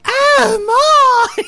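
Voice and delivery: very high, feminine shriek; high-pitched Night King. Pretend. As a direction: squeal it like a girly villain. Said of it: "super high-pitched and very feminine".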